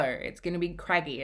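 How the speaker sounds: normal talking voice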